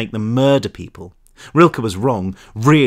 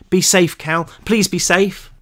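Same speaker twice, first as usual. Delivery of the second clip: higher, pleading farewell; worried, raised-pitch entreaty; anxious plea; 7 semitones higher, slower than usual. A narrator's reading. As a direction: plead with a friend at parting.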